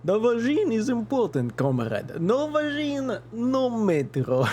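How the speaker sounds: mock a Russian comrade with a put-on Russian accent, dragging the last words; fake Russian accent. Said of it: "you would say this in a Russian accent".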